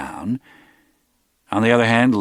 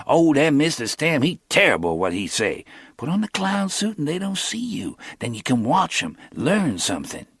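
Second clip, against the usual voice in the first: higher, quick street talk; lightly voiced young hustler; Cornell's voice; 8 semitones higher than usual.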